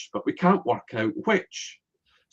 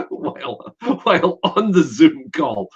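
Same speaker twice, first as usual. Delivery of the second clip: laughing through the words, higher and louder; 7 semitones higher, 5 dB louder than usual. Real, not acted. Recalling Hugo cycling on Zoom, laughing. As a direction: laugh it out in delight.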